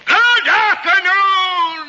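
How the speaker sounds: slow, high, shouted good afternoon; furious dismissal. Acted. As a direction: drag it out in a roaring rage. Scrooge dismisses nephew, final time.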